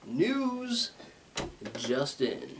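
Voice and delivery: mock news anchor. Joking to viewers, mock anchor voice. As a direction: ham up the newscaster for a beat. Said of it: "I'm gonna be bringing you".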